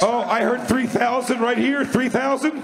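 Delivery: high, eager bid call; excited. Real, not acted. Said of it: "Just got excited".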